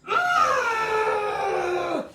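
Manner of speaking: high loud cry-like groan; frustrated groan. Pretend. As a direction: wail the groan in mock despair. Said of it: "Use noises".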